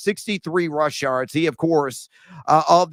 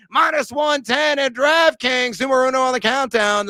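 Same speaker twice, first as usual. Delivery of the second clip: hyped sign-off; 7 semitones higher, 4 dB louder than usual. Acted. Hyped closing of pick.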